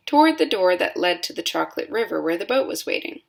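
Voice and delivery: plain reading voice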